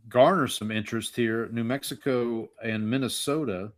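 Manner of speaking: ordinary talk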